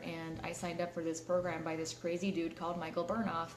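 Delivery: normal talking voice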